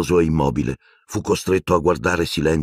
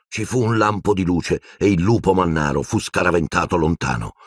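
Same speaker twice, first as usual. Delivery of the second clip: plain reading voice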